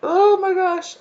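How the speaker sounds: real high, choked exclamation; holding back tears. Real, not acted. Reader moved by the spirit team's pride, really near tears. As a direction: squeak it out, fighting tears. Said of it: "trying really hard not to cry".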